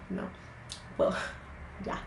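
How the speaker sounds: whispered with a laugh. Real, not acted. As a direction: laugh it off quietly. Embarrassed aside after apology.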